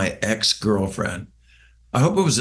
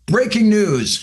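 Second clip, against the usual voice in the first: mock breaking news; 9 semitones higher, 3 dB louder, slower than usual. Mock news flash about Mitch.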